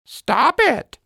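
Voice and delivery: high squawky parrot echo of "Stop it"; parrot copying. Acted. Parrot mocking Lizzie by copying, high parrot voice. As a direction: parrot mimicking her words back, cheeky. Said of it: "Parrot repeats".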